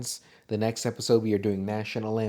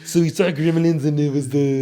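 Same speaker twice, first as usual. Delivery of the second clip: laughing, louder Arnold impression; 4 semitones higher, 8 dB louder than usual. Arnold voice recapping the movie, laughing. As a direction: push the Arnold accent through a laugh. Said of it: "I was just doing Arnold the whole time".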